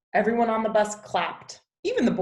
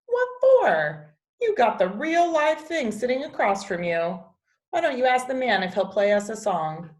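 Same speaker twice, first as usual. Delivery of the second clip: higher, louder, brisk retort; grandma nudging her grandson to ask for a song, louder and higher; lively grandma; 5 semitones higher, 4 dB louder than usual. Acted. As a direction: snap back with a playful push, quicker.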